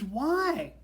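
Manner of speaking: high, loud mock-scream; screamed why. Coworker who won't take the hint, screaming. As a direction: imitate her hollering a demand. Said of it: "and she screams".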